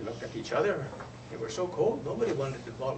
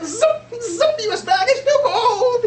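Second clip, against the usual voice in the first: much higher and louder, a tiny zipping hummingbird, laughing; 15 dB louder, slower than usual. Acted. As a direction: zip it like a hummingbird, then squeak the complaint. Tiny hummingbird zips up and back.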